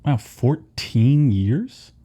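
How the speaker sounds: drawn-out disbelief. Real, not acted. Narrator amazed at reign length.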